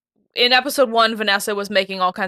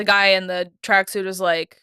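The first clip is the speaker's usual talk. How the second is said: normal talking voice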